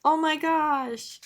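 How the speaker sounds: high, long-drawn mock disbelief; acted dismay. Comic girl with hands on head, mock dismay. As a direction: stretch it out in cartoon horror. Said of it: "her hands on her head".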